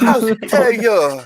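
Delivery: slow, high, mock-outraged soap star; telenovela drama.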